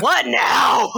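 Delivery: high, exasperated cry; hammed-up whiny shout; mock exasperation. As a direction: imitate someone fed up at yet another interruption. Acting out killer's exasperation.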